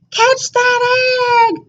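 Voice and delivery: high, slow call of alarm; chicken yelling. A narrator's reading. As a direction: call out to save the egg. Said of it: "poor Chicken yelled".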